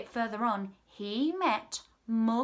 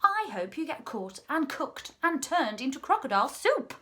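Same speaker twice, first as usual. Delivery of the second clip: higher-pitched storybook scolding; indignant outburst; 5 semitones higher, 4 dB louder than usual. A narrator's reading. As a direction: hurl a curse at the crocodile. Humpy Rumpy curses the crocodile.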